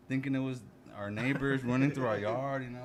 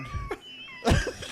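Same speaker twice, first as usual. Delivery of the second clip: squealing high laugh; high-pitched laugh. Real, not acted. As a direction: burst into a shrill laugh.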